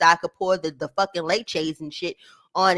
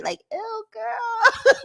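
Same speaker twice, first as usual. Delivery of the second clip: much higher, breaking into laughter; laughing disgust; 13 semitones higher, much slower than usual. Real, not acted. Vlogger cracking up at the clip, high laughing ew. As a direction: squeal ew through a laugh.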